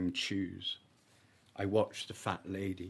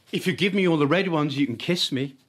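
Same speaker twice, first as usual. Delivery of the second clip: put-on teasing girl; 8 semitones higher, 10 dB louder, faster than usual. Girl teasing boy in woods.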